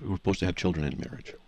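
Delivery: ordinary talk, nothing marked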